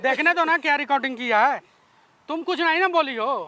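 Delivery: loud demand. Real, not acted. Brother loudly insists on seeing the recording during the scuffle. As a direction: loudly insist they let him see.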